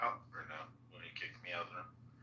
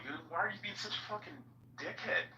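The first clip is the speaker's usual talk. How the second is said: exasperated challenge; 12 semitones higher than usual. Retold or imitated. Retelling own exasperated challenge.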